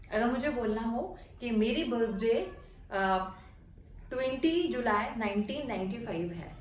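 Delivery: usual speaking voice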